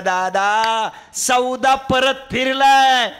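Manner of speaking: high, loud and slow; urgent cry. Acted. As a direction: drag out the bad news. मुलगा ओरडून बातमी सांगतो. (व्यवहार फिसकटल्याचे वडिलांना सांगणारा मुलगा, उंच मोठ्याने ओढून)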